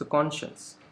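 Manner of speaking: Conscious with कॉन hit harder; stress on कॉन. Shown as a demonstration. Teacher models the right stress for students, कॉन strong. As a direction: lean on कॉन in conscious, shuns light. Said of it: "कॉन पे ज़्यादा stress रहेगा".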